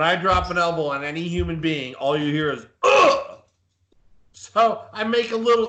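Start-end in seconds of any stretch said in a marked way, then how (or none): 2.8-3.4 sharp high grunt, much louder, knocked out of him; imitated impact grunt. Wrestler hit by the elbow, loud pained grunt. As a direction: punch out a winded grunt as the elbow lands.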